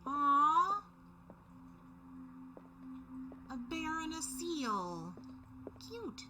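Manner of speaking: high, cooing aww. Real, not acted. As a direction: coo over something cute. Cooing over cute bear statue.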